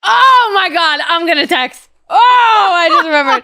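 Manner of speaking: high, loud burst of real excitement; excited. Real, not acted. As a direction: squeal it out as the idea hits. Remembers someone to text.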